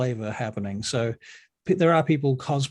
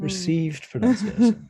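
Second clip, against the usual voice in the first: put-on posh accent; 6 semitones higher, 4 dB louder than usual. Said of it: "royal pronunciation".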